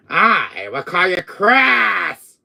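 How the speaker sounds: loud, high, drawn-out threat; shouted threat. Acted. Villain threatening Capcom with an insult, shouting. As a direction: stretch it into a slow furious shout.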